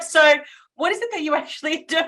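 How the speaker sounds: high, loud, laughing through it; laughing question. Retold or imitated. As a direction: laugh while you ask it, teasing. Mocking a rambling stranger.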